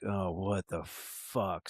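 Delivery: muttered curse. Retold or imitated. Muttering to himself in his truck as a stranger approaches, low and slow. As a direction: grumble it under your breath, slowly. Inner reaction as lady approaches.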